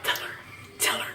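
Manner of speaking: barely audible whispered urging; secret whisper. Retold or imitated. Girlfriend urging boyfriend behind mom's back, secret whisper.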